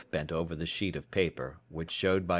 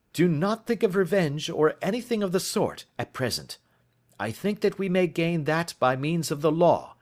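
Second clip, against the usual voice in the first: higher, brisk detective voice; detective pressing for evidence; Holmes urgent; 9 semitones higher, 4 dB louder than usual. Acted. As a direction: urge him on, impatient. Holmes's dialogue voice.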